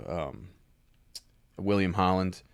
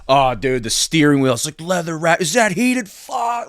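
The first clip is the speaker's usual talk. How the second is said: much higher, much louder gushing over the car; so excited; 8 semitones higher, 8 dB louder than usual. Retold or imitated. Customer on a test drive, thrilled by the steering wheel, gushing loudly. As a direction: gush like a kid with a new toy. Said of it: "Like, he's so excited".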